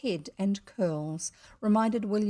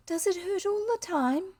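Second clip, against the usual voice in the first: put-on high, concerned child voice; solicitous little girl; 11 semitones higher than usual. A narrator's reading. Girl worried about boy's throat, solicitous. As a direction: fuss over him girlishly. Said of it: "Her solicitude was flattering".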